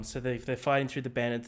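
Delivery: ordinary talk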